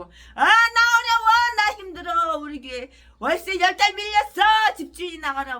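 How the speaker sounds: hammed-up poor-me plea; mock whining plea. Mocking a pastor texting for money, whiny pleading. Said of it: "사진 찍어가지고 수백 군데".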